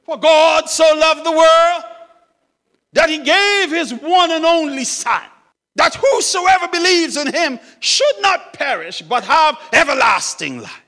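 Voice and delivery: loud, high proclamation; exultant reading. Acted. Old man finding John three sixteen, reading it out exultantly. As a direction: proclaim the verse at full voice.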